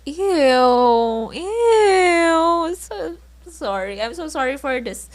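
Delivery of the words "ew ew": whiny, cry-like "ew" of real disgust. Real, not acted. Host cringing at her old crushes, whiny disgusted ew. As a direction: squirm out a pained ew. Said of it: "exhausted just talking about the".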